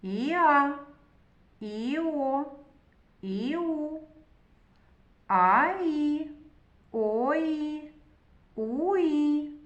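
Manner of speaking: each pair of sounds drawn out and joined; letters blended. Shown as a demonstration. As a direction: glide slowly from one letter into the next. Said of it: "поєднуючи букви між собою".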